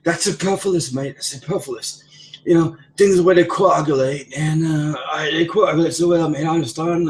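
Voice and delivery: put-on high-pitched English accent; Colt. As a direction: imitate a wordy, pompous coworker in an English accent. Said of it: "He always uses words".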